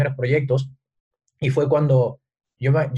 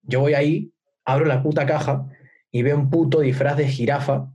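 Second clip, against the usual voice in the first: ordinary talk, nothing marked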